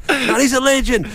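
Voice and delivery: high-pitched mock argument; arguing fan. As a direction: play the adoring fan protesting. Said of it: "I wonder the argument they would have had".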